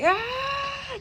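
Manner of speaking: sudden very high strained "ah"; a sharp cry as something gives; pushing cry. Retold or imitated. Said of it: "I'm like".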